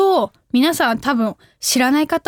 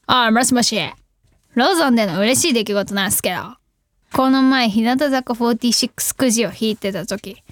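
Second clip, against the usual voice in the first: lowered voice of a listener calling in; low-pitched, casual caller role; phone caller; 4 semitones lower than usual. Acted. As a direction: play the caller recounting an incident. Plays a phone caller reading letter.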